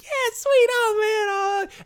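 put-on excited kid squeal. Kid retells opening Halloween candy.

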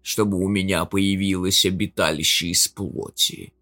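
demonic whisper. Acted. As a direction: whisper like a demon inside the head. Demon speaks in skull.

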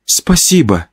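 lightly voiced woman; Olya's voice. Narrator thanking Pyotr, lightly in Olya's voice. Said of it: "ответил я голосом Оли".

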